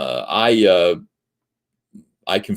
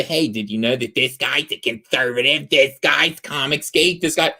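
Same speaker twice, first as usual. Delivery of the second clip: put-on voice of a busybody informant; mock someone eagerly reporting on people; mocking tattletale; 3 semitones higher than usual. Critic tattling to people about a conservative, mocking put-on voice.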